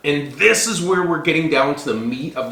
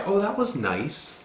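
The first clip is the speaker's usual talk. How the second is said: slow, high, softer faint praise; soft polite praise; 6 semitones higher, 6 dB quieter, slower than usual. Retold or imitated. Host voicing guests walking by after costly wedding, mock lukewarm praise. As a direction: imitate a guest's lukewarm compliment, drawn out. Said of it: "They use really soft words".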